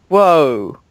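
a loud, sudden gamer's whoa; startled whoa. Real, not acted. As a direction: jolt at something appearing on screen. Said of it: "something just spawned in front of me".

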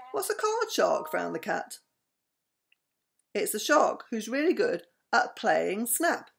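lively read-aloud with voiced animals; bedtime story reading. A narrator's reading.

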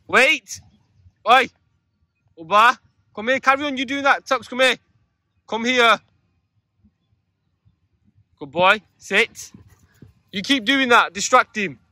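firm dog commands. Real, not acted. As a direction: bark the orders across the field.

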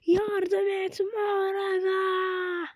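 high, slow, drawn-out desperate cry; Sukuna's cry for help. Retold or imitated. Streamer retelling anime fight, Sukuna shouts for help, high drawn-out plea. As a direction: stretch it out like a villain begging his ally. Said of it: "diye bağırdı".

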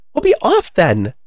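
high bird character; high-pitched storybook dismissal; dismissive pigeon. Acted.

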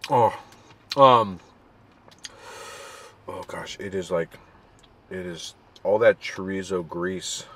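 chewing while talking; muffled words between bites; mouth full of burrito. Real, not acted. Seen 4: holds burrito, bites and chews throughout labelled words.

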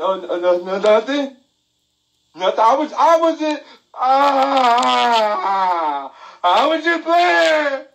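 high, nervous stammering excuse; scared kid. Retold or imitated. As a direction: squeak out an excuse to mom.

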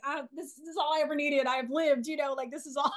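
high, breathless, overjoyed with a laugh; a kid who just got everything she wanted; giddy excitement. Retold or imitated. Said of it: "my childhood version of me was excited".

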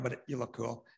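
ordinary talk, nothing marked

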